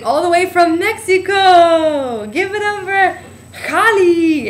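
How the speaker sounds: imitating a bar host hyping the crowd, slow and loud; hyped host announcement. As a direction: sell it to the crowd like a club emcee.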